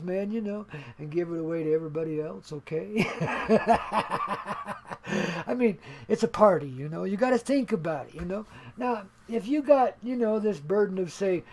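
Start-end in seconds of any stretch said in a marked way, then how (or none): 3.1-5.4 short laugh trailing off. Real, not acted. Host laughing after joking about giving wealth away, chuckle. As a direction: chuckle at your own joke.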